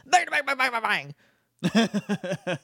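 high, twangy nonsense outburst; Southern cartoon gibberish. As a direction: yap it like a Southern cartoon sheriff. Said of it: "That's what this guy sounds like to me".

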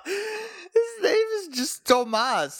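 high, slow, through real laughter; can barely get it out for laughing; choked-up laughing disbelief. Real, not acted. Cracking up at the name.

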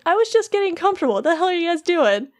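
high, hurried complaint of someone just disturbed; indignant wardrobe. Retold or imitated. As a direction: play it put out, pitched well up. Woken wardrobe complains to intruders.

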